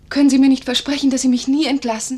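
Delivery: urgent plea. Acted. Nurse begging Brookwood never to dismiss her, loud. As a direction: beg him, voice rising.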